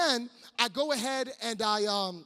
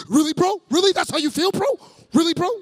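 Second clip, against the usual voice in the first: loud, higher challenge to the crowd; mock indignation; 7 semitones higher, 11 dB louder than usual. Preacher razzing middle schoolers, mock indignation. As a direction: act incredulous and call them out loudly.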